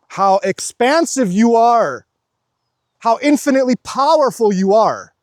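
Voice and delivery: high, loud outpouring of awe; impassioned exhortation. Real, not acted. Telling viewers how infinite they are, loud and impassioned. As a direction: belt it out with fervour.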